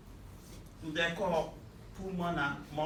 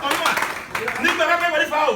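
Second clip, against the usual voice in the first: high, loud shouted line; rousing shout; 10 semitones higher, 10 dB louder, faster than usual. Real, not acted. Crowd leader shouting a high loud call to the rally.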